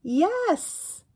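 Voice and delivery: high, loud storybook voices; high playful picture-book voices; character voices. Acted. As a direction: act out the question and answer brightly.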